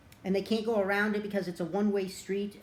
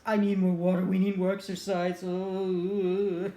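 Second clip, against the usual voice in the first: a hammed-up winded gasp, louder; mock exhausted; 4 dB louder than usual. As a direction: play a worn-out cat panting for water. Exhausted Pete, out of breath.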